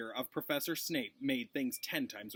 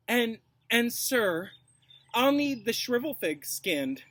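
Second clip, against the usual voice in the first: higher, louder, gloating; malicious glee; 9 semitones higher, 6 dB louder, slower than usual. Acted. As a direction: savor the order with spite. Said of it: "full of malicious laughter".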